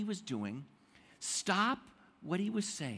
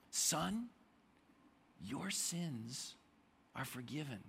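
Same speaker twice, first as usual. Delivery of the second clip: soft and gentle, trailing quieter; tender, gentle Jesus voice; 2 semitones lower, 6 dB quieter, slower than usual. Retold or imitated. Jesus gently forgiving the paralyzed man, tender and soft. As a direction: speak it like a kind father to a son.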